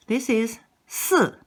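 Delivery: 四 said with a sharp falling fourth tone. Shown as a demonstration. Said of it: "四 has accent number four".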